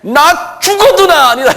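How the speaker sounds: loud, high, slow oath; cursing denial. Acted. Peter cursing and swearing to bystanders, vehement denial. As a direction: deny it as if on your life. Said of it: "저가 저주하여 맹사해".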